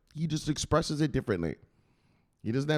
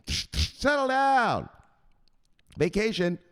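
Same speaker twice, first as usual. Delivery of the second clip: drawn-out high scolding; bossy enforcer; 10 semitones higher, slower than usual. Acted. Enforcer hearing about a vacation, high scolding. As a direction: stretch it out as a high, incredulous telling-off. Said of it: "let me give you an example".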